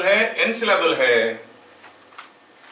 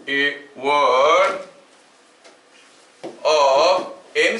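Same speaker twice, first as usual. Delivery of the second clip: word by word, drawn out, with long pauses; slow dictation; much slower than usual. Real, not acted. Teacher giving students notes to write, slow dictation. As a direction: dictate slowly with long stretched syllables.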